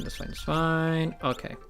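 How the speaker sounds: normal talking voice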